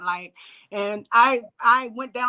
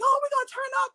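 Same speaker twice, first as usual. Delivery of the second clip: opgewonden; 13 semitones higher, faster than usual. Retold or imitated. Retells singers hyping a night out.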